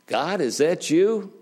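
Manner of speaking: high, slow, wondering question; acted incredulous awe, rising at the end; awed question. Fisherman asks the mysterious voice.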